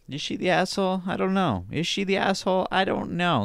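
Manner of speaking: high-pitched put-on dithering; mock dithering. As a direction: squeak out the indecision, playfully. Mock dithering over the verdict.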